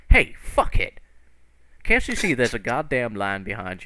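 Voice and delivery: accost him sharply in character; acted aggressive attention-grabber; hostile call. Character accosts line cutter.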